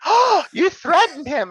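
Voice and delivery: high, slow, prissy mock gasp voice; mock the offended chat, high and drawn out; mocking offended girl. Mocking chat viewers, high offended girly voice. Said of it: "all the offended little girls in the chat".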